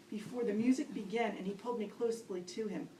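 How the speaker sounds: normal talking voice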